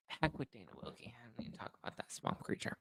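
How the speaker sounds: dismissive whisper, barely audible; whispered aside. Real, not acted. Muttering to himself about his notes, whispered aside. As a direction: drop to a whisper under your breath.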